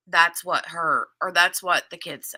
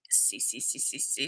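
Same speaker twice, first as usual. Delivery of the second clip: ordinary talk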